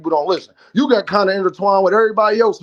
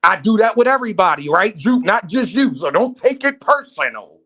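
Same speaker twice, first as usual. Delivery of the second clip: ordinary delivery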